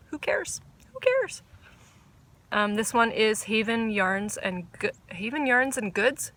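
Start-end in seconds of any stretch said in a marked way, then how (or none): 0.1-1.4 who cares. Real, not acted. Playful dismissal, rising pitch.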